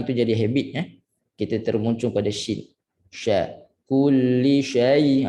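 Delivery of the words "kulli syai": slow "Kulli syai" recited; slow Quranic "syai"; drawn-out recitation. Shown as a demonstration. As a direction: chant "syai" long, lips flat. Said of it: "mungkin nampak macam sedap muncul".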